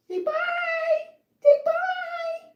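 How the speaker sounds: high squeaky cat voice; high baby voice. Acted. Voicing goodbye for cat.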